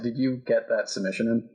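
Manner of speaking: ordinary talk, nothing marked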